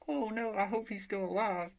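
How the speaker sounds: high, hammed-up worried whimper; moping. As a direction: fret in a put-on high female voice. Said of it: "section of her moping around".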